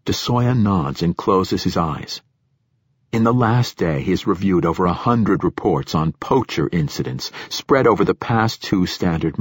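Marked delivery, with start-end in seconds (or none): none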